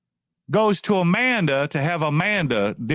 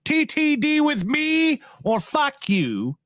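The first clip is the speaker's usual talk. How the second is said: higher, slowed, put-on defiant voice; imagined defiance; 10 semitones higher than usual. Vlogger voicing Hensley's ultimatum to YouTubers, high mock defiance. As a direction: sneer out the ultimatum, drawn out. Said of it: "He could have done that".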